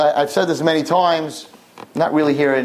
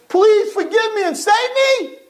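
pleading. Acted. Imagined repentant plea.

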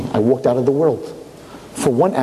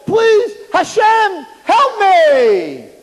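much higher, louder, terrified pleading; panicked screaming; 4 dB louder than usual. Acted. Chaim screams to Hashem, terrified of Gehenna. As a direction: cry out in terror for help. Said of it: "he starts screaming".

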